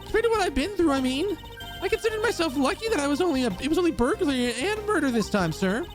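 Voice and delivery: put-on bright high-pitched young woman; high girly voice. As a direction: squeak it out, eager and star-struck. Same girl character continues.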